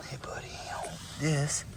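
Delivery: near-silent hush to the dog; whispered greeting. Real, not acted. Owner greeting dog in the pen, hushed. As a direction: breathe it so as not to startle.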